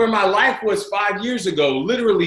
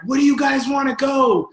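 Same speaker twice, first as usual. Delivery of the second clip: eager dad question; 7 semitones higher than usual. Retold or imitated. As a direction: ask brightly, with a rise of excitement. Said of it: "I'm like".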